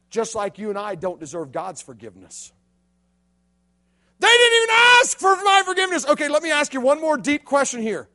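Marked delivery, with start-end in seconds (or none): 4.2-6.1 higher and louder, complaining; whiny listener. Retold or imitated. A listener objecting to the preacher, whiny and high. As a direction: protest like a wronged teenager. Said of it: "listener's objection".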